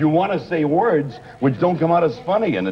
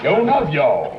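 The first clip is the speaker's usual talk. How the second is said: higher, comic put-on Southern dialect; 4 semitones higher than usual. Comic topping a joke about dialects, mock Southern accent.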